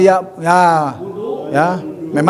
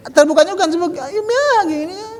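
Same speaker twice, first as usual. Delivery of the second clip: ordinary delivery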